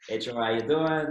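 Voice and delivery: put-on loud, friendly Boston accent. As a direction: greet him in a Boston accent. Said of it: "in, like, a Boston accent and said".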